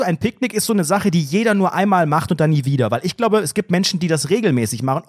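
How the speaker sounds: ordinary talk, nothing marked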